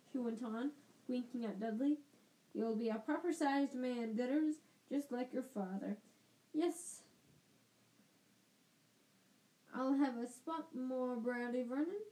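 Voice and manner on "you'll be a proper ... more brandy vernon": lightly voiced bossy aunt; a hint of a pompous aunt; Aunt Marge voice.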